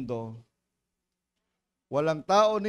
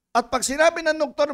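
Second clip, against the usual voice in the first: ordinary delivery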